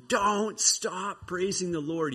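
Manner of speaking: high, drawn-out mock shout; yelling. Encouragement yelling to stumbling Josiah, acted yell. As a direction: call down urgently, stretching the words. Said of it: "He's just yelling at him".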